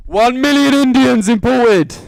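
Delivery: hammed-up announcement yell; mock shout. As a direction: bellow the punchline, drawn out. Joke answer shouted after refusing.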